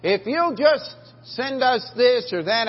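high, loud, slow televangelist appeal; TV preacher pitch. Retold or imitated. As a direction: draw it out like a TV fundraiser. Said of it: "the guy's there saying".

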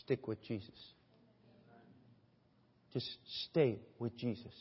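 soft, hushed counsel with a pause; quiet appeal. Real, not acted. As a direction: urge them gently, almost privately. Preacher's quiet, intimate appeal.

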